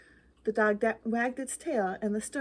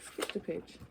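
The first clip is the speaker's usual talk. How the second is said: whispered aside about a skipped page; 4 semitones lower, 10 dB quieter than usual. Real, not acted. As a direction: hush it to yourself as an aside. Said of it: "Uh-oh".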